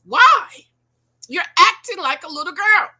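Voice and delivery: high, loud, fed up; exasperated outburst. Real, not acted. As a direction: throw it out in disbelief. Exasperated rant at women.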